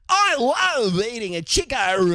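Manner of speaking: put-on high, slow broad Australian kid voice; imitating an Australian child from old footage; mock kid with broad Aussie accent. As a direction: ham up a thick-accented eighties Australian kid. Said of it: "some kid and he'd be like".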